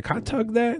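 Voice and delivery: put-on light high kid's question; little kid voice. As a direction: ask it like an eager little boy. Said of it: "and I said".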